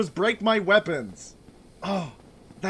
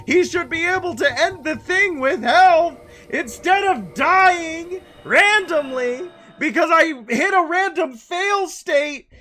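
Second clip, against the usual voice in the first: angry rant; 6 semitones higher, 3 dB louder than usual. Real, not acted. Ranting at the game director.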